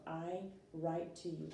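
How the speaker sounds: slow, deliberate example sentence. Shown as a demonstration. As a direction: lay the words out slowly for the class. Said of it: "for example".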